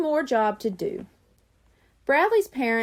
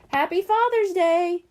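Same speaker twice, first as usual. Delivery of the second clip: cheerful yell; 7 semitones higher, 3 dB louder than usual. A narrator's reading. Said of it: "they all yelled".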